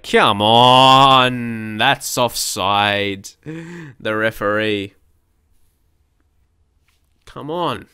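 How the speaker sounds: long drawn-out, exasperated "come on"; slow groaning protest at the referee; exasperated complaint. Real, not acted. Gamer venting about a conceded penalty, drawn-out exasperation. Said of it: "I get mad about conceding penalties".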